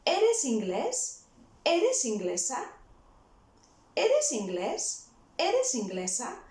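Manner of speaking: "Eres inglés", "Eres inglesa" each rising at the end; question tone. Shown as a demonstration. Tutor showing learners the shorter question, question tone. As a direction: ask "Eres inglés" and "Eres inglesa" with a clear final lift. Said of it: "make it sound like a question".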